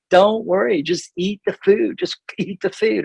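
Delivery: imitating wife. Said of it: "my wife is always like".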